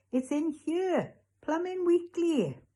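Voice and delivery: high, drawn-out triumphant cry; excited discovery. Acted. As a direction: call out your find slowly and high. Boy discovers missing magazine.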